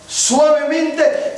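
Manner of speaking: "suavemente" estirado, muy despacio; lento y suave. Shown as a demonstration. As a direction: alarga "suavemente" despacio, como deslizándose. ("suavemente" dicho con lentitud extrema)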